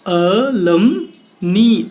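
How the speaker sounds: अलुमनी very slowly, split "a... lum... nee"; teacher drawing out अलुमनी, last part like "knee"; syllable-by-syllable pronunciation. Shown as a demonstration. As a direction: stretch अलुमनी, ending in long "ee". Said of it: "के लिए हम use करते हैं अलुमनी".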